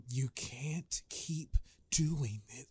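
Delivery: slow, hammed-up whispered movie line; mock the hushed spy drama; whisper yelling. Imitating whispered movie dialogue.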